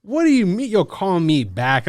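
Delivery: high, loud indignant demand; hammed-up offended disbelief; mock outrage. Told to wait for a callback, in mock outrage.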